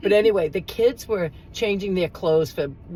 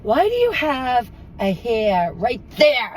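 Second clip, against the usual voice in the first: put-on slow, curious childlike voice; a small girl's innocent question; little girl's question; 5 semitones higher, slower than usual. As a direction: ask like a nosy little girl. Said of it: "and then she says".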